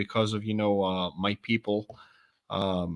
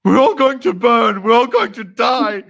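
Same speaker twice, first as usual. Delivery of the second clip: high, loud crazed British villain; hammed-up hysterical doom cry; gone crazy; 10 semitones higher, 9 dB louder than usual. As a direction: wail like a raving madman, drawing out the final words. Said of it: "you just go crazy".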